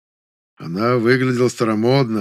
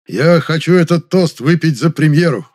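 loud, high celebratory proclamation; rousing toast; 5 semitones higher, 6 dB louder than usual. A narrator's reading. Director jumps up to toast the premiere, loud and rousing. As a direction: call it out to the whole table.

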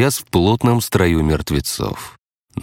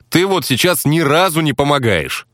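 much higher, irritated reprimand; sharp rebuke; 10 semitones higher than usual. A narrator's reading. Narrator reining in the role-player, sharply irritated. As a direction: cut him short, pitched right up. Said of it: "одёрнул ролевика я".

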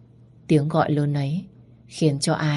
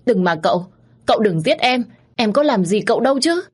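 rising, louder frightened begging; pleading in fear; 6 semitones higher, 4 dB louder than usual. A narrator's reading. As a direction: beg him desperately not to kill you. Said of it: "tôi cố gắng van xin cậu".

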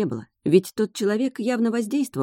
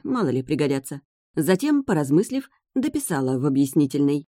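plain reading voice

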